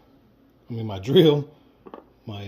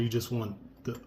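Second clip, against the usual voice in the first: usual speaking voice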